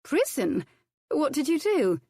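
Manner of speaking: high startled questions; shocked lady. A narrator's reading. Violet startled by the cook's prison past, high. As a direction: gasp it out in disbelief.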